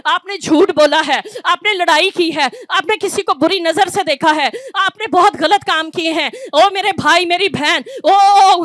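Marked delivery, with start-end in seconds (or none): none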